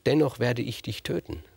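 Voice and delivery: ordinary delivery, nothing marked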